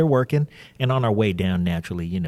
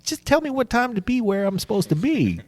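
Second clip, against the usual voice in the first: high, comically indignant outburst; mock incredulity; 10 semitones higher than usual. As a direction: act floored by such a needless question. Mock disbelief at friend's casual question.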